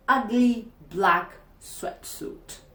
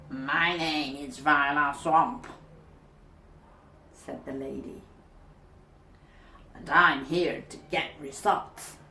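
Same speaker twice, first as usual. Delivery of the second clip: stern Viola Swamp; 6 semitones lower than usual. Acted. Viola Swamp introduces herself.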